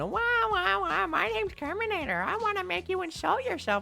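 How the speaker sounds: high, sneering mock voice of a chatter; squeaky taunting impression; mocking whiny voice.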